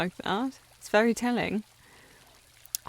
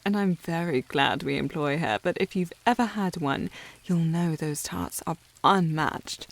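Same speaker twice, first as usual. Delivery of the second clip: ordinary delivery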